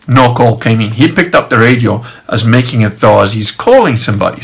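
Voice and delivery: ordinary delivery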